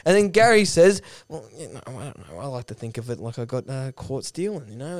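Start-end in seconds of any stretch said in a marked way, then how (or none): 1.3-5.0 very soft, low mumbled excuse; sheepish mumble. Retold or imitated. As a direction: imitate someone caught out, muttering low. Gary caught out, answering sheepishly.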